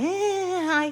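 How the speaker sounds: high, airy mock greeting; breezy neighbor greeting. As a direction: mimic the neighbor breezing past. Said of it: "She's gonna jog like".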